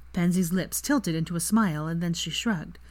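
ordinary delivery, nothing marked

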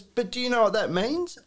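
high retold challenge; questioning young lad. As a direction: imitate a lad quizzing someone. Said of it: "this young lad goes".

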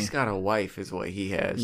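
muffled, hand over mouth; talking into hand. Real, not acted. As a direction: speak through your hand, muffled. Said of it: "I keep talking into my hand".

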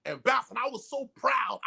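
ordinary delivery

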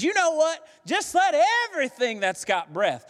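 high-pitched, exuberant burst of praise; exploding excitement. Acted. Preacher voicing the psalmist at the end, exploding with excitement. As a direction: burst out as if the praise cannot be held in. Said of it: "at the end it just explodes".

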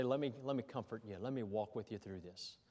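soft and low, as if to a grieving friend; gentle comforting offer. Acted.

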